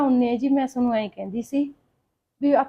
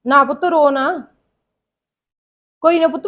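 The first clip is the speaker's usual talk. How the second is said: put-on soothing a crying child; 4 semitones higher, 7 dB louder than usual. Mother soothing crying child on phone. (Phone ਤੇ ਰੋਂਦੇ ਬੱਚੇ ਨੂੰ ਹੌਲੀ ਹੌਲੀ ਚੁੱਪ ਕਰਾਉਣਾ)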